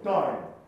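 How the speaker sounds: loud ダイン with a bright アイン; clear ダイン. Shown as a demonstration. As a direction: hit ダイン crisp and clear. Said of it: "日本語の大根のダインじゃなくて". (明るくはっきりしたダイン)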